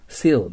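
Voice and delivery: seal with a clean s, "see-l", firm fall; corrected pronunciation. Shown as a demonstration. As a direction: correct the mistake with a clear English seal. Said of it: "って言っちゃダメです".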